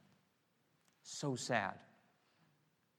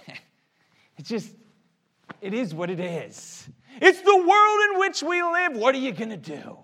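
mock shrug; 9 semitones higher, 9 dB louder than usual. Mocking a resigned attitude.

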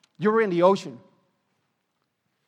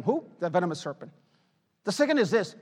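normal talking voice

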